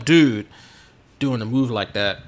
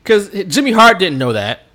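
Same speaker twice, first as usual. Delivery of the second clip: normal talking voice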